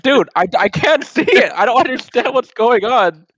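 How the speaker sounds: high, loud frustrated complaint; exasperated outburst. Retold or imitated. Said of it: "I'm like".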